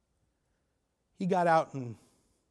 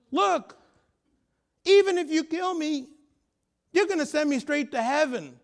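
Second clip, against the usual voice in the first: high-pitched, insistent challenge; acted bold defiance; 8 semitones higher, 3 dB louder than usual. Father facing a threatening gang member, boldly defiant.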